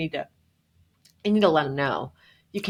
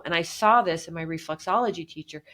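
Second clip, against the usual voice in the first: ordinary talk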